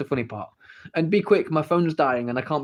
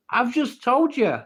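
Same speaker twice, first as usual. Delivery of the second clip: higher, slow, impatient repeat; mock exasperated mother; 10 semitones higher, 4 dB louder, slower than usual. A mother losing patience with her child, mock exasperated. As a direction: stretch out the impatient reply. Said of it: "bedtime story".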